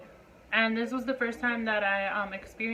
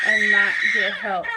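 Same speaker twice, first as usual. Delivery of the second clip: louder, half-laughing cry of disbelief; laugh-shout the last words louder; 2 semitones lower, 9 dB louder than usual. Real, not acted. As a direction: burst out loud with a bitter laugh. Laughing outburst at lack of help.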